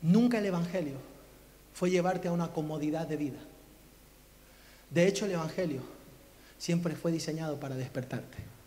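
low, measured pulpit gravity; hushed, earnest appeal to the congregation; solemn preaching. Real, not acted. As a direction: let each phrase settle before the next.

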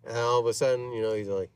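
ordinary delivery

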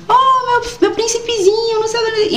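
put-on high cooing grandma voice to a baby; lightly voiced doting grandmother; mother's baby talk. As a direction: coo like a grandmother to her grandson. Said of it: "que tinha a voz da minha mãe".

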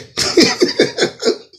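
real high-pitched giggle burst; high laugh. Real, not acted. Comic amused by his own parody, high laughing. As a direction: crack up loudly, very high.

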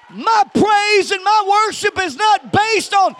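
loud, high, shouted preaching; fiery revival preacher; preaching at full force. Acted. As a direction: preach it hard to the crowd.

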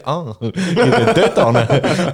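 laughing. Retold or imitated. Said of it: "und checken".

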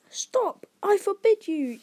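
high, loud panicked shout; panicked yell. A narrator's reading. Uncle Vernon stopping Hagrid's revelation, panicking. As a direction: yell to cut him off. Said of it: "yelled Uncle Vernon in panic".

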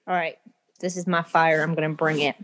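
ordinary talk

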